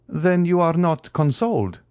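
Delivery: a touch of surprise; surprised. Acted. Count asks Morrel if he is not consoled, lightly surprised. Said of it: "asked the Count, surprised".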